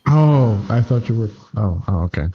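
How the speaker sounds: normal talking voice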